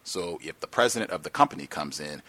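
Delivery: normal talking voice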